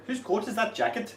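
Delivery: a quick Welsh phrase with a laugh in it; playful Welsh accent. Retold or imitated. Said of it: "Can you do a Welsh accent?".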